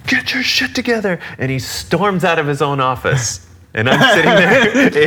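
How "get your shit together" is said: very high, strained imitation of yelling; mock enraged shout. Music director storming out on his drummer, mock enraged shout. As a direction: do the boss blowing up, voice shooting up high. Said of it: "shouting, like, in a, in a ball of rage".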